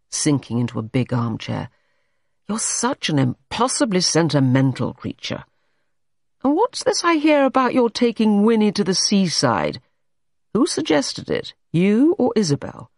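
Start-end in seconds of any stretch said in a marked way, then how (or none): 2.5-12.9 scold, then demand answers; exasperated man scolding. A narrator's reading. Everard quizzes Jane about the seaside trip, exasperated. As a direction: pitch climbing into an indignant interrogation.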